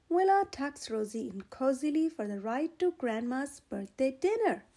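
plain reading voice

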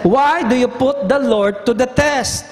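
high, earnest plea; pleading Moses. Retold or imitated. Moses rebuking people.

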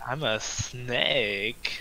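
very slow, drawn-out silly snake; playful snake voice. Pretend. Player doing a silly snake bit for crewmates, drawn-out. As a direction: stretch the words out like a playful snake.

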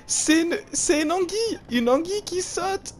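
high-pitched, slowing squeal. Real, not acted. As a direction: squeal in disbelief. High squeal about eel.

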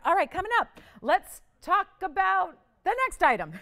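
high, loud show-host call-out; excited announcement. Real, not acted. Host moving viewers on to the next item, excited announcement.

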